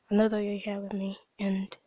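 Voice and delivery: soft, hushed whisper to God; whispered prayer. Real, not acted. Quiet closing prayer.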